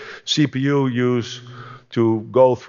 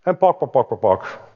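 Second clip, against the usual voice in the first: mock pecking sounds. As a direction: imitate a chicken pecking grain. Eaglet pecking like chicken.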